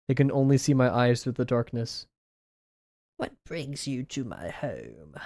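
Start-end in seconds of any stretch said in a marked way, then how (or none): 3.2-5.1 very soft, slow bad British witch voice; a creepy old witch greeting guests, barely above a hush; wicked witch. Witch at her cottage door greets visitors in a soft, slow, creepy voice. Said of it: "a bad British accent in a high-pitched voice".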